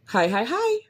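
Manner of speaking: high, bouncy hi hi hi; upbeat greeting. Shown as a demonstration. Podcaster trying an energetic opener, high bouncy greeting. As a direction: chirp it out cheerfully. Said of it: "I could do".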